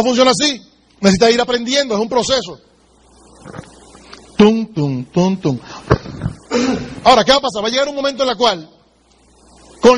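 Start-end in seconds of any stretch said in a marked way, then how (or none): 4.4-5.6 low "tum, tum" beat; imitated drumbeat. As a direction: voice a steady heartbeat. Imitating a heartbeat or drum. (latido imitado, grave)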